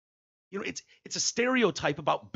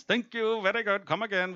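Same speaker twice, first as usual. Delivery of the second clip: higher-pitched mock Indian accent; Indian shopkeeper accent; 6 semitones higher than usual. Comic as Seven Eleven owner, mock Indian accent. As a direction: put on a cartoon Indian storekeeper accent. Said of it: "Indian guys who own seven elevens".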